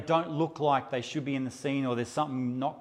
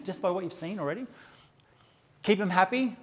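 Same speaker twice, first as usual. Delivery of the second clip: ordinary delivery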